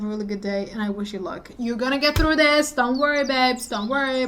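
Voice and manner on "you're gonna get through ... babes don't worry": loud encouragement. Real, not acted. Loud cheering goodbye.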